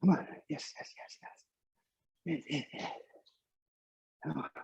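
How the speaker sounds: breathless whispered yeses on the climb; cycling hard. Real, not acted. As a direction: push out the yeses with no voice. Urging through the steepest hill.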